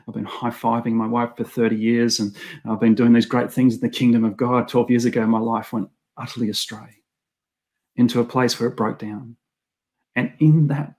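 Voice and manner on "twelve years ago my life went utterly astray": usual speaking voice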